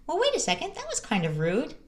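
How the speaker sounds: a put-out parent, half joking; offended. Pretend. Parent noticing the child's rudeness, mock offended.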